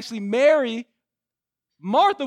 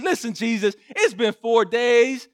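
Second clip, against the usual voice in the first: high, loud, incredulous protest; pitched up in mock disgust, laughing; disgusted mourners; 9 semitones higher, 4 dB louder than usual. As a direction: mourners protesting to Jesus about opening the tomb. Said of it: "They said".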